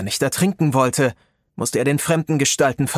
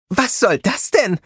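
higher squeaking voice; squeaky; 7 semitones higher, slower than usual. A narrator's reading. Little sorceress squeaks indignantly at Rob. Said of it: "Ihre Stimme quiekte".

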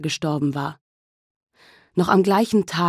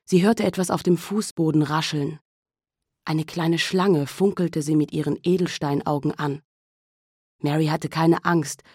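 usual speaking voice

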